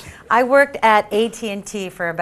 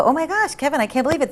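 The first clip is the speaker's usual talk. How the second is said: gushing; 7 semitones higher than usual. Retold or imitated. Gushing to crush about sushi.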